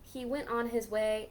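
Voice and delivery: ordinary delivery, nothing marked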